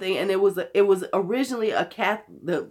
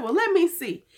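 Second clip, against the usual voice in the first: retold self; 8 semitones higher than usual. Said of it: "I said".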